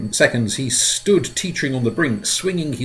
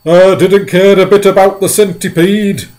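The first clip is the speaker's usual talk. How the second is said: high, loud wailing lament; sobbing earthworm; 8 semitones higher, 11 dB louder than usual. Acted. Earthworm sobbing over the lost boy, wailing. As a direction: wail it through tears. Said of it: "the earthworm sobbed".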